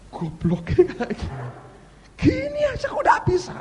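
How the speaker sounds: very high, incredulous mock scolding; ejekan geli. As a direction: scoff at your old self in a squeal. Mocking own old test papers. (ejekan melengking tak percaya)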